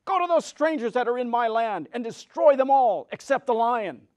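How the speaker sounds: loud, high wicked witch command. A narrator's reading. Wicked witch ordering the monkeys to destroy strangers, loud and high.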